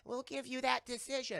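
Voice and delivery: high, slow cartoon alien voice; little monsters. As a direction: offer it in a small, deliberate squeak. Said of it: "and they're like".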